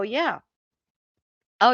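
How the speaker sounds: ordinary talk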